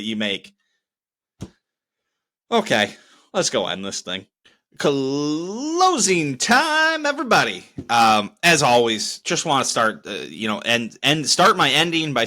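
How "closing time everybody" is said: announcer call. Real, not acted. Host announces the show's end.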